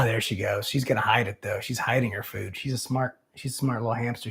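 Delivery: softly watching a pet hamster; hushed. Real, not acted. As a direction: keep it down so the hamster isn't disturbed. Hushed while watching hamster hide food.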